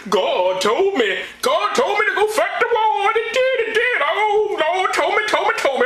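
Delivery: shouted high fanatic voice; mock zealot. Mocking a child soldier claiming God ordered war, shrill shout. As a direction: ham up a crazed soldier hearing God.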